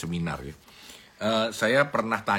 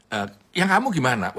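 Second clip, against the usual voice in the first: boss asking louder; 5 semitones higher, 6 dB louder than usual. Retold or imitated. Boss asks the next employee.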